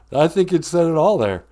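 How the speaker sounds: ordinary talk, nothing marked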